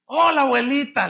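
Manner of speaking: exaggerated syrupy hello; showy pastor greeting. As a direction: play the slick pastor charming her. Showy pastor greets old woman.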